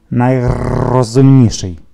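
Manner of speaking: slow, loud, long rolled R; authoritative parrot. A narrator's reading. Parrot confirms the father's praise, slow and authoritative. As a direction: confirm it weightily with a rattling parrot R. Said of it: "авторитетно підтвердив папуга".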